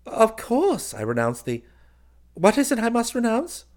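high, loud, obliging puzzlement; kindly. A narrator's reading. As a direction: humour him, rising into a baffled question. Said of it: "said kindly".

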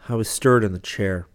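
ordinary delivery, nothing marked

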